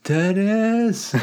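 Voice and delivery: high, drawn-out cry to the sky; anguished yell. Retold or imitated. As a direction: howl the name skyward. Echoing the mock yell.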